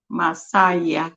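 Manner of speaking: ordinary talk, nothing marked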